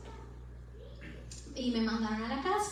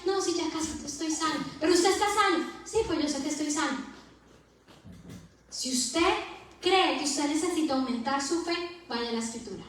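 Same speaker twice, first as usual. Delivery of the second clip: louder, higher, driving sermon cadence; rising pulpit exhortation; fervent preaching; 4 semitones higher, 9 dB louder than usual. Acted. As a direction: preach it to the congregation with fire. Preaching at full force.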